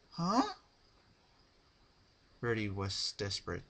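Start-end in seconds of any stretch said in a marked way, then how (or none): 0.1-0.5 high, puzzled chirp; a bright innocent huh; Pinkie Pie voice. Acted. Pinkie answering Rarity's plea, high and puzzled.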